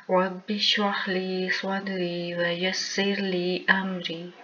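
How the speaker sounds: chanted Arabic prayer, drawn out; measured devotional recitation; Quranic recitation. Acted. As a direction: recite the opening duas devoutly.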